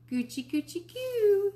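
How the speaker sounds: put-on high, cooing baby talk to an infant. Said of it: "she said to Tootsie".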